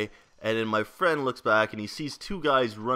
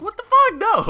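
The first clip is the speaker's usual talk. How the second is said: a sudden, much higher and louder shriek; shocked yell; 11 dB louder than usual. Retold or imitated. Told the thief has a gun, shocked high yell. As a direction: shriek it in disbelief.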